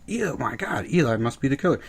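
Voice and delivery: high fake gasp; mock audience. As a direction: parody the predictable guess. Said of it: "Episode eight".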